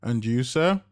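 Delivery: courteous question to the gentleman; waitress turning to the husband. A narrator's reading.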